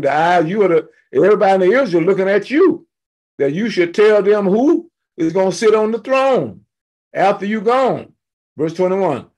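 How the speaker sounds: fired-up Bible-class preacher retelling scripture; fired-up preaching. Real, not acted. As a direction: preach the scripture with rising excitement. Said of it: "I get very fired up about this".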